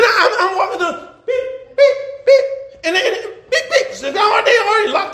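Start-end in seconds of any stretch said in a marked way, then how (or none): 1.3-2.4 very high electronic chirp; imitated car-lock beep. Pastor mimicking cars locking as he walks by, high beeps. 3.5-3.9 quick high chirps; imitated car-lock beep. Pastor mimicking people locking their doors, high beeps.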